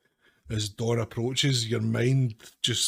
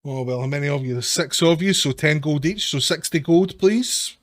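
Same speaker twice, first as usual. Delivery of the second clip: lightly voiced gang boss, louder and higher; businesslike highwayman, raised voice; cheery toll boss; 5 semitones higher, 7 dB louder than usual. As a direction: tot up the toll brightly. Toll boss acted, naming the price.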